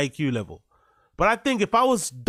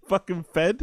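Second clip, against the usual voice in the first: usual speaking voice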